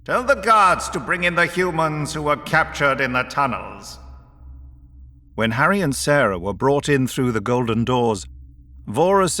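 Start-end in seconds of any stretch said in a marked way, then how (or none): 0.0-4.0 higher-pitched, commanding call; calling after someone. A narrator's reading. Vorus sends Magric for the captured humans, calling after him. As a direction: call the order after a departing underling. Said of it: "Vorus called after him".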